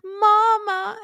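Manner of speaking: put-on very high, small child's cry; hurt little boy calling his mom, high. He was like.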